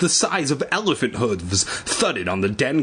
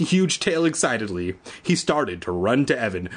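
plain reading voice

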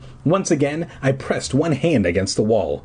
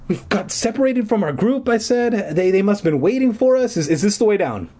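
high, stammering nervous voice; nervous girl; 11 semitones higher than usual. A narrator's reading. Sister, lost, nervously asking the silent stranger. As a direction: speak up through the fear.

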